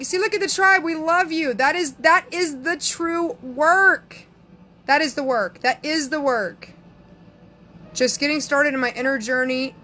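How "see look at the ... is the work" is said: higher, emphatic celebration; excited, emphatic approval; overjoyed. Real, not acted. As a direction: cheer them on, stretching the key words. Overjoyed at viewer's comment.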